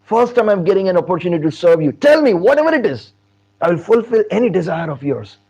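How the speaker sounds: delighted eagerness rising in pitch; acted happy, eager devotion. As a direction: build into an overjoyed offer. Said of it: "Hanumanji became so happy".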